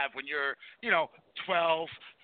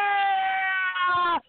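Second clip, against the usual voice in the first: long, high, held mock cheer; imitated ridiculous yell; 12 semitones higher, 7 dB louder than usual. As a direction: stretch out a loud, silly slow-motion "yeah". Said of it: "the noise that's coming out of her mouth".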